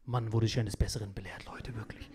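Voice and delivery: hushed rueful whisper. Real, not acted. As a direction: drop to a whisper. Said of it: "unvoiced aside". (geflüstert, reumütig)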